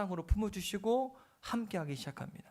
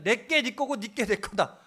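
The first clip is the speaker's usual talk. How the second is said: loud, high, slowed-down pleading father; a father calling out to his elder son, insisting; father tells his elder son all he has is his, loudly and high; 6 semitones higher, 8 dB louder than usual. Acted. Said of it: "그 아버지가 큰아한테 얘기하는 거".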